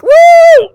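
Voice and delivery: very high, loud celebratory "woo"; piercingly high cheer, imitating excitement; imitated excited whoop. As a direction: let out a mock-thrilled whoop. Retold cheer at the news.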